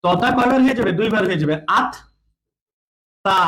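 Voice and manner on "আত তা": আত, then তা, said as two separate syllables; teacher sounding out আত ... তা; syllable-by-syllable demo. Shown as a demonstration. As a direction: break it into আত and তা with a clear pause. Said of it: "এরকম হবে".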